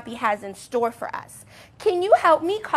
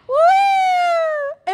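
high loud whoo; kids' rolling cheer; 4 dB louder than usual. Acted. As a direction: whoop as you roll back.

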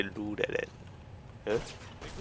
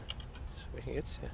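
whispered mutter; 14 dB quieter, slower than usual. Real, not acted. Puzzled mutter.

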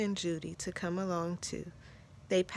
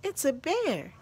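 high-pitched startled announcement; surprised exclamation; 7 semitones higher, 4 dB louder than usual. A narrator's reading. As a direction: exclaim it in astonishment. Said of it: "he exclaimed".

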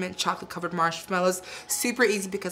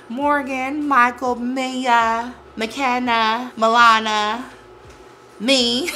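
proud roll call; 3 semitones higher, 9 dB louder, much slower than usual. Real, not acted. Proudly listing family names.

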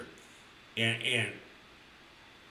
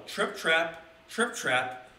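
slow, spaced hoofbeat reading; slow trip trap; 4 semitones higher, much slower than usual. A narrator's reading. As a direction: Trip Trap drawn out slowly, word by word. Reading the goat's hoofsteps.

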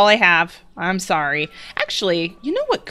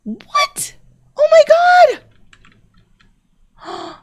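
much higher, breaking into squeals; real squealing excitement; 3 dB louder, much slower than usual. Real, not acted. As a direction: shriek with disbelieving joy. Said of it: "way more excited than I need to be".

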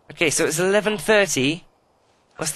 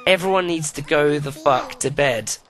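ordinary delivery

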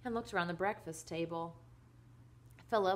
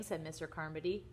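plain reading voice

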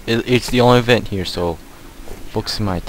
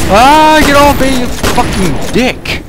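high, much louder, dragging out the insult; frantic yell; 14 dB louder, slower than usual. Real, not acted. Gamer grabbed by a monster, frantic shouting. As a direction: scream it while fighting it off.

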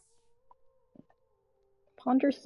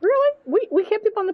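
incredulous; 10 semitones higher, 4 dB louder, faster than usual. Real, not acted. Disbelief the kid still needs potty.

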